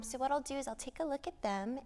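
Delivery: normal talking voice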